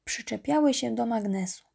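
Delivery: ordinary delivery, nothing marked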